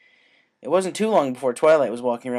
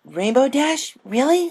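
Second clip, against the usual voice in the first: put-on much higher, louder little girl thrilled; excited filly; 7 dB louder, slower than usual. A narrator's reading. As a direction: gasp out her hero's name. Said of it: "her eyes widening with excitement".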